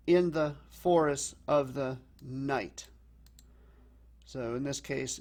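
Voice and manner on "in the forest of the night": slow, loud beats on "in", "forest", "of", "night"; pounding trochaic stress. Shown as a demonstration. Teacher showing a more pounding feel, trochaic stress landing on "night". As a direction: pound out the first of each pair in "in the forest of the night". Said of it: "a more pounding feel to it".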